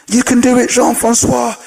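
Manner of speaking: high-pitched acted cheer; rally him like a believing crowd. Crowd encouraging Jean-Francois on the rope, acted cheering.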